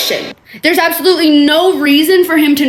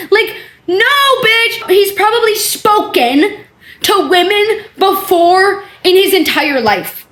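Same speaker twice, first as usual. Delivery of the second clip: high, slow, incredulous scold; exasperated scolding; 7 semitones higher, slower than usual. Real, not acted. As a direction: talk down to her slowly. Scolding the texter.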